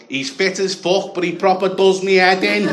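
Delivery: Scouse bird. Retold or imitated. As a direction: imitate a Scouse girl complaining about her fella. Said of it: "if that was a Scouse bird".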